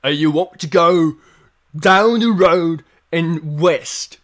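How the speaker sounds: slow, loud guard captain; gruff town guard giving directions. Acted.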